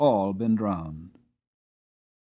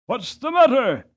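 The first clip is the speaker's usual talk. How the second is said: much higher, louder call back; shouted; 12 semitones higher, 7 dB louder than usual. Acted. Master shouting back to the toll-gate man. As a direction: shout across the dark water. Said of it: "shouted my master".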